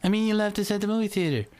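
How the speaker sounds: put-on high, fast squeaky-kid correction. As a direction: redo it quickly as a whiny little kid. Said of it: "I'd be like".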